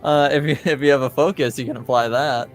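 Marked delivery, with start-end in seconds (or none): none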